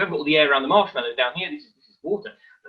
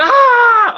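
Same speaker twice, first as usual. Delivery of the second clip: sudden high, loud yelp of fake agony; mock scream of pain; 12 dB louder than usual. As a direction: fake a yelp as if the water burns. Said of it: "I'm not screaming in agony".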